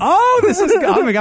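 bursting out high and loud; can't hold back the thrill; excited. Real, not acted. Host hears the article was inspired by them, high and loud excitement.

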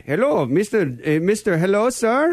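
imitated Indian call-centre caller; polite phone scammer with an Indian accent. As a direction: put on an Indian accent, eager and formal. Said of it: "with a strange Indian accent".

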